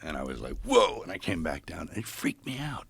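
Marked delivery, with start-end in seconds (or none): 0.6-1.0 high, loud startled whoa; sudden shock at floating. Retold or imitated. Floating up the wall, high loud startled cry.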